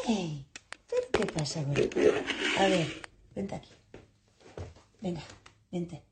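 quiet, gentle call to a scared puppy; soft coaxing call. Retold or imitated. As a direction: coax the pup in softly, barely above a murmur. Coaxing puppy to come closer.

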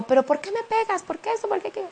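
high, pleading questions to a husband who hits her; pleading battered wife. Acted. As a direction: beg him to explain why, voice climbing high. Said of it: "cuando yo estoy leyendo el libreto". (mujer maltratada suplicando, voz muy aguda)

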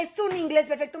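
usual speaking voice

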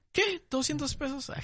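high incredulous squeak; mock pawnshop shock. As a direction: play a stunned customer.

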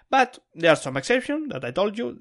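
normal talking voice

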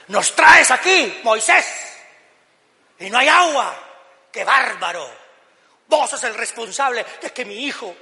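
loud, high-pitched accusing outburst; acted angry complaint. Preacher as thirsty people blaming Moses, indignant. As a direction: hurl the blame loudly, hammed-up outrage.

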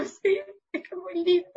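high-pitched imitation of crying; mock tearful voice. Singer retelling her tearful answer to the video editor, mock crying. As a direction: squeak it out as if welling up, playfully. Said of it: "yo estaba llorando".

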